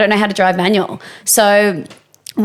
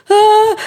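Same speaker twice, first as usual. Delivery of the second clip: high, loud short "ah"; imitated cry; 13 semitones higher, 5 dB louder than usual. Recalling the moment of shock, imitated yelp. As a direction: mimic a shocked little yelp.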